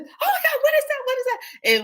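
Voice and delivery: very high mock-frightened squeal; mock panic. Girl pranking guy behind her, mock panic. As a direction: play up the fake terror up high. Acts out second woman's prank.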